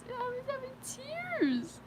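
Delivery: high mock-crying imitation; imitating her high voice. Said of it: "she goes like this".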